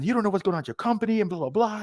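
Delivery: fast, high mock scolding; retold boss scolding. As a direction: rattle it off like a boss chewing you out.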